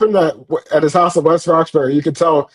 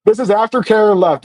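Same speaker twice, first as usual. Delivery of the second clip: ordinary talk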